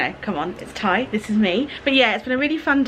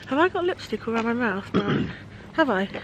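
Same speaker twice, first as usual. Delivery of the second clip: ordinary talk, nothing marked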